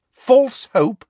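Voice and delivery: high, loud incredulous repeat; outraged schoolmistress question; indignant echo. Acted. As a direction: throw the phrase back. McGonagall indignantly echoing Umbridge.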